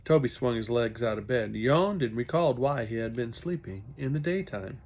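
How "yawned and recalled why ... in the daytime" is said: ordinary delivery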